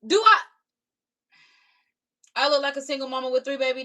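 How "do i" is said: loud, high indignant "Do I"; indignant outburst. Real, not acted. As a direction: blurt it out in disbelief. Reacción indignada al insulto.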